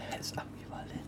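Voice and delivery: hushed mock-awed whisper. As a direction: breathe it like a secret. Mocking a fancy word.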